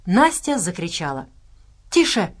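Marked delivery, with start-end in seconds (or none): none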